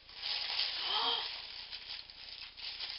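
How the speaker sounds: long breathy gasp of surprise; astonished gasp. Real, not acted. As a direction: draw in a long, amazed breath. Opens parcel, astonished at gift.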